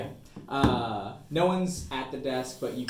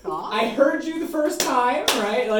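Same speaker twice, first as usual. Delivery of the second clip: annoyed shopkeeper; 10 semitones higher, 8 dB louder, slower than usual. Acted. Annoyed gnome at bell.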